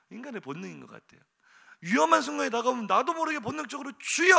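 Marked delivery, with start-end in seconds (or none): 4.0-4.4 loud, high "주여" cry; desperate cry. Retold or imitated. Person in danger crying to God, loud cry. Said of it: "하고 외치는 그 외마디 비명".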